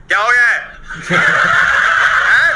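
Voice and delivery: gently asking what's wrong; loving dad. Retold or imitated. Father coming over to soothe his son, tenderly. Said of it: "प्यार से बोला".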